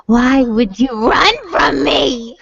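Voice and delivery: slow, drawn-out plaintive question; mock creepy plea. Retelling chasing a fleeing player, mock creepy plea. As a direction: plead like a wounded stalker. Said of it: "I was just like".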